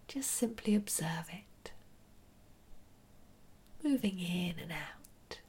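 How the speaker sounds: soft whispered meditation guidance; right in your ear. Acted.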